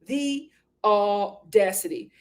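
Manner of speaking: AUDACITY stretched out, syllable by syllable; slow, emphatic "the audacity"; drawn-out indignation. Real, not acted. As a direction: break AUDACITY into slow, separate beats. Repeats it drawn out, broken up.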